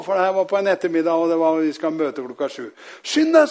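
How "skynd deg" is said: high, loud hurry-up shout; urgent urging. Retold or imitated. Daughter-in-law urges him off before the meeting, urgent shout. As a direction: imitate an urgent call to hurry.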